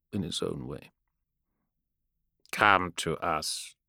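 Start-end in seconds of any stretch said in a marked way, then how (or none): 2.5-3.8 slow, dead machine voice; mechanical voice. Acted. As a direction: speak like a lifeless vox speaker. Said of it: "said the mechanical voice".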